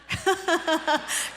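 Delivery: short burst of laughter; amused laugh. Real, not acted. Preacher after mimicking a yelling mother, amused laughing. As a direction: laugh at your own imitation.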